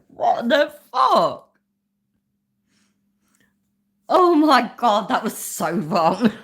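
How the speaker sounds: loud, high, disbelieving shout; shocked. Real, not acted. Shocked reaction to clip.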